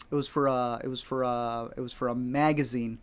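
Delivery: low, halting stall; hesitant bluffing. Retold or imitated. Caught without credentials by the lady with the notepad, hesitant. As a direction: stumble quietly toward a made-up answer.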